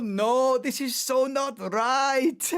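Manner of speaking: loud high pleading radio call, dragged out; team boss on the radio. Retold or imitated. Team boss pleading with Michael on the phone, loud and slow. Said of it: "you've got the team boss on the phone going".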